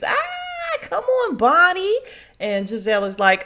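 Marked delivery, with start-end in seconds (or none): 0.0-2.0 much higher, louder squealing cry; thrilled squeal at the video; excited scream. Retold or imitated. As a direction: shriek with delight. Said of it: "Candace screams".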